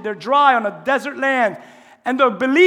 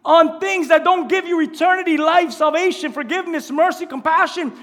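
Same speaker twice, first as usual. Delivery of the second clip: preaching shout; 6 semitones higher, 4 dB louder than usual. Retold or imitated. Preacher almost screaming at the distracted church, loud urgent cry. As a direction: plead with the church at full force. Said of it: "He's almost screaming to the church".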